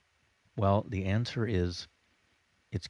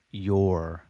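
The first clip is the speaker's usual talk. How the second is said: "your" slightly louder, marked out; 8 dB louder than usual. Shown as a demonstration. Teacher demoing embedded command, your said louder. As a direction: punch "your" a little above the rest. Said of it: "say them more loudly than the rest".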